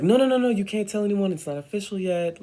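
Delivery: high, loud, rapid-fire objection; urgent hushing protest. Retold or imitated. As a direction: cut them off fast, high and urgent. Retold caller urgently stops her telling.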